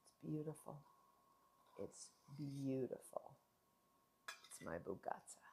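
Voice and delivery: soft, low, awed murmur; awed whisper. Real, not acted. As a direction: whisper it like a treasure. Admiring slice, very soft.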